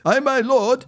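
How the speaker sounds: high answering call; calling back. A narrator's reading. Retainer answering through the mist, high call. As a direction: answer your master, pitch well up.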